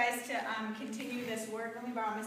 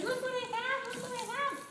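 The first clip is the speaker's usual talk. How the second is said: much higher, playful enticing voice; playful lure voice; teasing sing-song; 13 semitones higher than usual. Retold or imitated. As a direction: tempt the dog with the toy. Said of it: "oh, she says".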